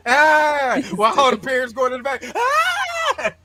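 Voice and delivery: loud high shriek; hammed-up scream; mock zombie scream. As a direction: screech at the zombies. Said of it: "I'm like".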